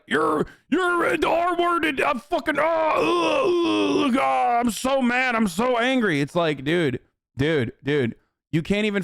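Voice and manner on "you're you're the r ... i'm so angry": mock angry rant. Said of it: "So he's like".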